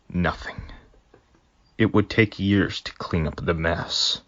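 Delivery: usual speaking voice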